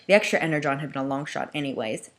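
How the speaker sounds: usual speaking voice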